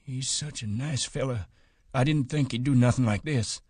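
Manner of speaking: put-on old Candy, upset. A narrator's reading. Candy's voice in the story.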